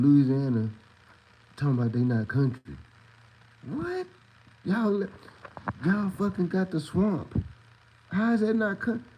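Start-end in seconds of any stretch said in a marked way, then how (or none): 3.7-9.0 pitched way up in disbelief; high, slow, can't-believe-it outrage; incredulous. Real, not acted. Ranting at Louisianans who deny being country, high and incredulous.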